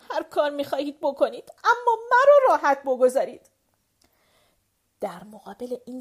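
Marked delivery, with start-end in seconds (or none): 0.1-3.5 rising into a tearful, much louder plea, pitch climbing high; a fed-up girl breaking into tears and begging them; crying outburst. A narrator's reading. Fiancée Julie, overwhelmed, crying out to leave her alone. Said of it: "شروع به گریه کرد و گفت".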